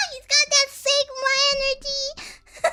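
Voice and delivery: high squealed words through real laughter; laughing hard. Real, not acted. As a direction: crack up at your own pun while talking. Cracks up at own pun.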